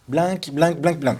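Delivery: mock the mistake, "blanc" as "blink, blink"; rapid-fire wrong "blanc", k sounded; wrongly sounded C. Shown as a demonstration. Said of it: "c'est pas blanc".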